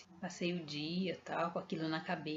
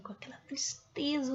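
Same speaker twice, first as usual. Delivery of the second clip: breathy whispered aside; 6 semitones higher than usual. Real, not acted. Remembering his sad gaze, whispered. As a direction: hush it out, sorrowful. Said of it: "whispered aside".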